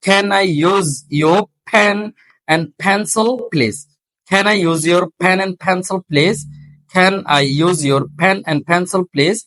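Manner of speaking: very slow, deliberate reading with breathy stops; slow drill. Shown as a demonstration. Teacher slowly modelling a question for students. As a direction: read it slowly, puffing each stop. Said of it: "আরটা বাদ দিচ্ছি".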